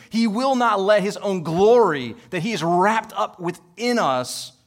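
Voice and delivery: loud, rising preacher's climax, high and driving; preaching at full force. Acted. Pastor driving home God's promise to the congregation, full-force preaching. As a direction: preach it out with mounting fervor.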